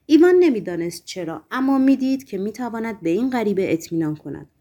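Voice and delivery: usual speaking voice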